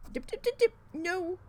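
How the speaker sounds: high, loud sing-song mock of orders; mocking the boss. Imitating the boss's rule for a listener, high, loud mockery.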